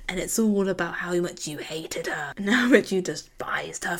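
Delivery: whispered accusation, mock menacing; hushed mock accusation; mock dramatic whisper. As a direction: hiss it like a soap villain. Acting detective accusation.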